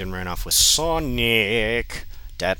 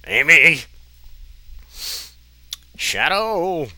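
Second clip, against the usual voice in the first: loud high mock climax cries; hammed-up cries; 10 semitones higher, 9 dB louder, slower than usual. Reading a bad fanfic sex scene aloud, mock cries. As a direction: shout the names way up high, overacted.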